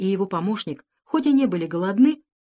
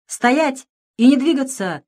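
slow, higher, louder threatening command; menacing shout; 7 semitones higher, 5 dB louder, slower than usual. A narrator's reading. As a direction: bark a slow threat to stop them. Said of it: "закричал маленький Джон грозным голосом". (грозный медленный окрик)

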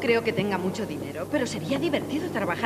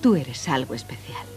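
tender whisper; 7 semitones lower than usual. Acted. Tender compliment.

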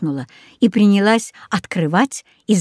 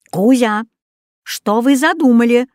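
loud bark; 3 semitones higher, 5 dB louder than usual. Acted. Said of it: "громко рявкнул Рекс".

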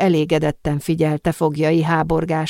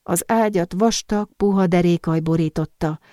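ordinary delivery, nothing marked

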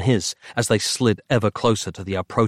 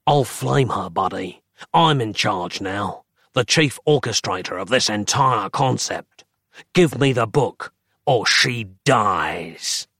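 threatening rasp. Acted. Dark fraction threatens.

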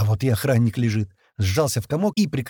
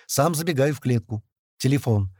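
usual speaking voice

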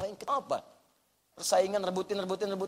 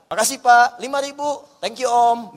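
louder, cheery mock thanks; parking attendant thanks; 3 semitones higher, 10 dB louder than usual. Preacher mimicking a parking attendant thanking a driver, cheerful. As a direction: play the delighted attendant getting a tip.

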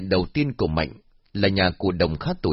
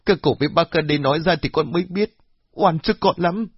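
put-on high, pleading young woman; 7 semitones higher than usual. Daughter pleading with the elders, high and desperate. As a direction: beg the elders to believe you.

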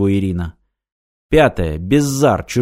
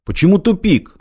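higher, indignant question; a sharp, offended protest; 8 semitones higher, 4 dB louder than usual. A narrator's reading. Martin protesting Irina's verdict, indignant. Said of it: "возмутился Мартин".

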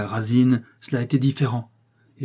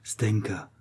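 hushed, whispered name; chuchotement; 5 dB quieter than usual. A narrator's reading. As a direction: whisper it with a finger to the lips. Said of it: "elle mit un doigt sur ses lèvres". (chuchoté, tout bas)

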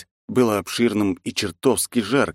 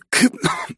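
harsh cough. Acted. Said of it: "закашлялся снова".

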